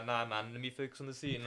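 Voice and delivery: a light put-on character voice; casually waving them off while staying in role; in-character brush-off. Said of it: "reverting to my".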